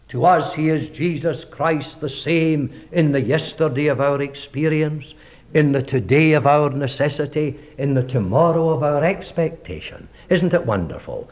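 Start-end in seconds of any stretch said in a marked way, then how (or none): none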